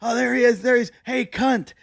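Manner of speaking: high-pitched imitation of heckling fans; mock yelling. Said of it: "They start yelling".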